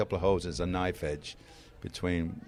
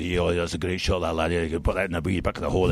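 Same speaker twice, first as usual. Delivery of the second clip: lower, rolling commentator voice; low, quick-running golf commentary; Bob Tom; 4 semitones lower than usual. Acted. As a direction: impersonate a folksy golf commentator, pitched down. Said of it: "when I do my, my Bob Tom".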